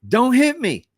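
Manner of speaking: high, loud cry of warning; imitating a stranger hollering in the fog; retold alarmed shout. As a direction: yell it high, as if about to be rammed. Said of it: "this voice from very close says".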